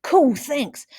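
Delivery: high, loud thrilled thanks; excited young boy; delighted boy. Acted. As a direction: burst out with delight at the gift. Boy opening his present.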